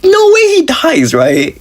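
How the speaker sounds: very high, slow squeak of disbelief; disbelieving squeal. Retold or imitated. To her mom, watching Odysseus seem to die: high squeal of disbelief. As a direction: shriek it like you can't accept it. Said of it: "I was like".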